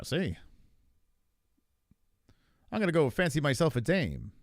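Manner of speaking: old-time fast-talking broadcaster impression; Tom Aspinall. Streamer mimicking a fighter's weird voice, hammed-up fifties broadcaster. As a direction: do a hammed-up Transatlantic fifties newsman. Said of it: "that's what Tom talks like".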